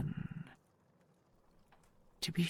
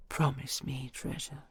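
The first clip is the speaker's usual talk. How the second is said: normal talking voice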